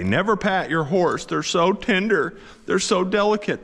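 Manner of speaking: high, whiny mock know-it-all. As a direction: whine it like a smug online commenter. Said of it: "I have to do it in a whiny voice".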